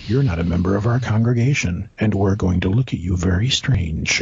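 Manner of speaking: low-voiced, wary church members; suspicious congregation. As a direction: drop low like disapproving locals eyeing an outsider. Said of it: "when I walked in, they're like".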